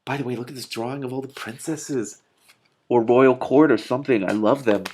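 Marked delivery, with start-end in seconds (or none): none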